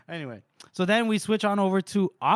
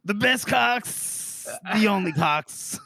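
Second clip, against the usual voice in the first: slow dramatic; 5 semitones higher, slower than usual. Acted. Dramatic build.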